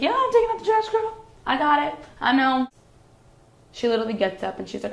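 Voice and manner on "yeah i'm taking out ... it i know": breezy reassurance. Retold or imitated. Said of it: "And I was like".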